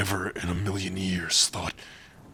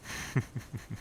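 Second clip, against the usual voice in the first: soft laugh; 3 semitones higher, 9 dB quieter than usual. Acted. Soft laugh, intimate moment.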